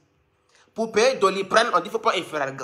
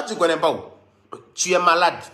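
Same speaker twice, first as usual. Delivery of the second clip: ordinary talk, nothing marked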